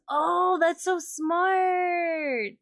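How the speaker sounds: loud, high, drawn-out delight; wowed, long high cry; impressed exclamation. Real, not acted. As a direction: stretch it out in amazed admiration. Impressed by a viewer's tip.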